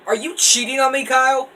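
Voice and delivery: high, loud fake outrage; teasing friend with fake jealousy; mock jealous shriek. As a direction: ham up the betrayed lover. Joking jealous outburst at friend.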